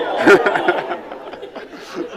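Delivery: loud unvoiced laugh. Real, not acted. Brewer laughing after greeting the beer, loud laugh. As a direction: burst out laughing.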